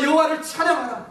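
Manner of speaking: high, loud call to praise; loud command. Retold or imitated. Pastor ordering his own soul to praise, loud and high. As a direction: shout "여호와를 찬양하라" as a ringing order. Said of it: "저의 영혼에게 이렇게 말했어요".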